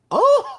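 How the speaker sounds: sudden high, loud "oh"; high whoop. Acted. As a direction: let out a piercing excited "oh!".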